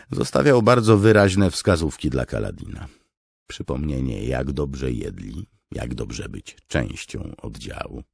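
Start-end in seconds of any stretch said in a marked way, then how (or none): none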